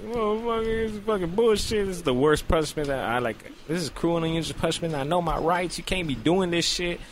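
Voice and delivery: high-pitched comic ranting in pain; agonised protest. Retold or imitated. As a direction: complain high through a painful exam. Acts protest during painful exam.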